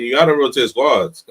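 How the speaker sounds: usual speaking voice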